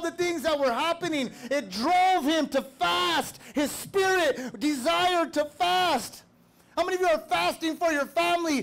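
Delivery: high, impassioned sermon delivery; forceful preaching. Real, not acted. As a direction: preach it hard, hammering the repeated words.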